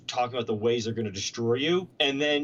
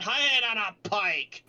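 higher and slow, relishing "Head on a pike."; savoured orc threat; 9 semitones higher, slower than usual. Retold or imitated. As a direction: draw out "Head on a pike." slowly, tasting how badass it sounds. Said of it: "But it sounds really badass".

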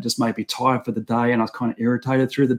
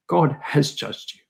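friend's gentle reply; 4 dB quieter than usual. Retold or imitated. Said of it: "And he goes".

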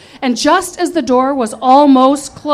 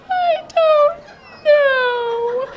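higher, tearful whine; retold blubbering; 14 semitones higher, much slower than usual. Drunk narrator confessing to her sister, tearfully. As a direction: mildly play the drunk crying. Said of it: "I sobbed".

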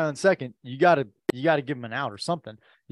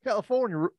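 attempted Southern accent, pitched up; half-hearted put-on Southern accent; 7 semitones higher than usual. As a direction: try out an Alabama coach's Southern accent. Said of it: "pretend like you were that coach".

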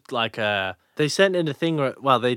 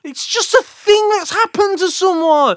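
incredulous; 8 dB louder than usual. Real, not acted. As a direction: stretch out the disbelief, laughing. Baffled by remote post.

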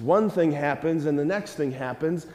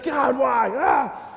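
higher, half-shouted wail at God; mock anguished cry; 5 semitones higher, much slower than usual. Sick kid crying out to God, mock anguished wail. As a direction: ham up a frustrated cry to heaven. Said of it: "You're like".

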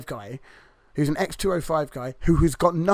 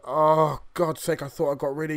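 exasperated groan. Retold or imitated. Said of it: "just puts his head in his hands, like".

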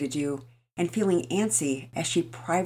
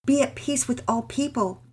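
a touch higher, sincere pleading mother; lightly voiced earnest mother; 5 semitones higher, 5 dB louder than usual. Mother to husband, lightly voiced and earnest. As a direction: gently voice a devout mother explaining herself. Mama Peters voiced by narrator.

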